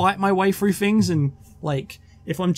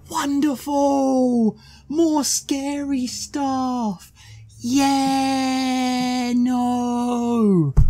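sarcastic; 5 semitones higher, much slower than usual. Acted. More scary stuff in horror game.

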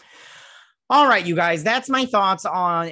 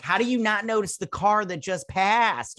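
mock exasperation; 8 semitones higher than usual. Scolding the show's characters in retelling.

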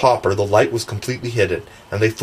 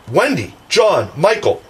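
higher-pitched calling of names, one by one; crying out; 6 semitones higher than usual. A narrator's reading. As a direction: cry out each name in mild alarm. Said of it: "the three cried simultaneously".

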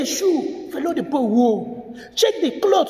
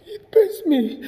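slow, tearful and choked; real sobbing; crying; 3 semitones higher, slower than usual. Real, not acted. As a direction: push the words through tears. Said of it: "I'm sorry for breaking down".

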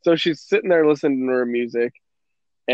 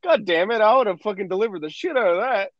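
mock frustration; 7 semitones higher than usual. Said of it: "I was so fucking mad".

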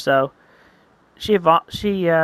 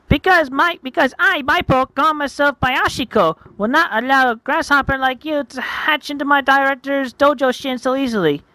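high, emphatic anime challenger; defiant Maipo; 8 semitones higher, 3 dB louder than usual. Acted. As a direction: declare the challenge in a high, haughty voice.

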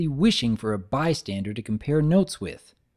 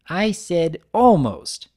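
slow, louder, teasing baker; Mister Cake voice; 5 semitones higher, 5 dB louder, slower than usual. Acted. Baker teasing his friend, slow and loud. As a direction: draw it out with a wink.

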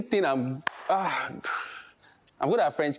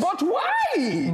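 put-on high, puzzled Indian accent; 11 semitones higher than usual. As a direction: ask why in an Indian accent. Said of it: "Indian accent".

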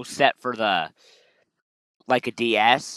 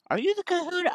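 put-on falsetto girl voice cracking on a high note; 5 dB quieter, faster than usual. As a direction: let the high girly voice break. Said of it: "Ah, the voice crack".

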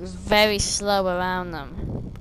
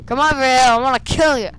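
taunting; 4 semitones higher, 7 dB louder, faster than usual. Real, not acted. Taunting guard in game.

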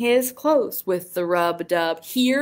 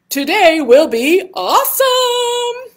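high, loud cheer; rally the kids up; overbright kids party host; 9 semitones higher, 6 dB louder than usual. Acted. Cheering final affirmation.

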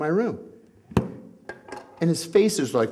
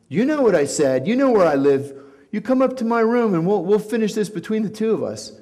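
mock threat; 6 semitones higher, 4 dB louder than usual. The guy's retold threat.

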